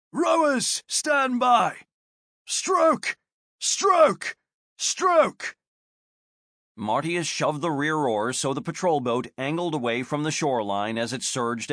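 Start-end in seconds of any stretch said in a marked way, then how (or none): 0.1-5.6 high shouted rowing calls; gruff barked parade orders. Acted. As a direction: call the stroke loud and sharp. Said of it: "the sergeant announced".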